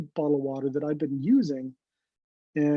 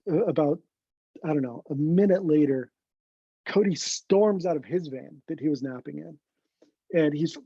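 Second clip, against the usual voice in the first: normal talking voice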